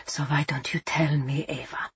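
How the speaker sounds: whispered, menacing. Acted. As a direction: breathe it close to her ear. Captain leans in to whisper.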